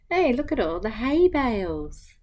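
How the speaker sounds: ordinary delivery, nothing marked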